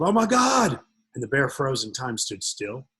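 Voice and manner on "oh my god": high, loud panicked cry; shrieking for help in sudden panic; terrified yell. Retold or imitated. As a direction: yelp it as the bear lunges. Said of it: "the atheist professor yelled".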